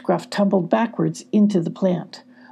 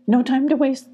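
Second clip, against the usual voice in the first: higher, urgent; urgent cry; 7 semitones higher than usual. A narrator's reading. Lucas urging the group to flee, urgent. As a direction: hurry them along. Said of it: "cried Lucas".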